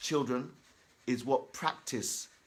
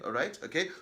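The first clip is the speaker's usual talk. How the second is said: ordinary delivery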